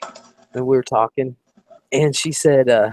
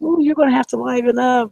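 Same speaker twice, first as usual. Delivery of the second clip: playful egging on; 12 semitones higher than usual. Retold or imitated. Two girls urging shy friend to liven up, playful. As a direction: imitate party girls coaxing a shy one.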